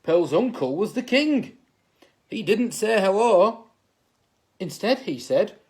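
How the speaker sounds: ordinary delivery, nothing marked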